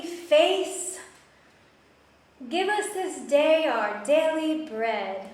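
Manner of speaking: loud recited bedtime prayer; half-sung prayer, said with feeling; praying aloud. Acted. Says her prayers aloud by bed.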